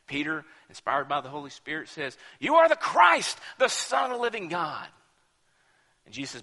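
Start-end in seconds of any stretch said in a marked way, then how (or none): 2.4-4.9 ringing and much higher on You are the Christ; bold declaration. Retold or imitated. Peter answering Jesus, bold and ringing. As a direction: proclaim it with awe.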